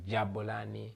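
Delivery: "Jabulani" with a "j" and a "b"; "Jabulani" opening on "j" as in jam; pronounced variant. Shown as a demonstration. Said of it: "some people pronounce it as".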